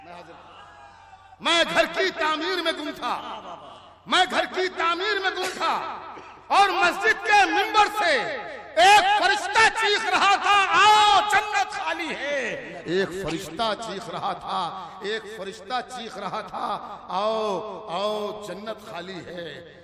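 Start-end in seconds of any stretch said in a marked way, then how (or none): 1.5-8.2 high, loud mushaira recital; declaimed poetry. Acted. Recites verse to audience. 8.8-12.5 high, shouted declamation; forceful verse recital. Acted. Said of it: "किसी शेर पर जोर लगाऊंगा". 12.9-19.8 slow, measured recitation; relaxed verse recital. Acted. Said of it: "किसी शेर को आराम से पढ़ूंगा".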